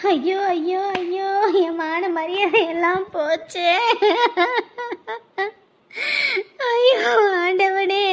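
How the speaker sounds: slow, drawn-out sobbing cry; crying out in dragged-out grief; wailing lament. Acted. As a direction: lament a disgrace to the heavens. Wailing over lost family honour.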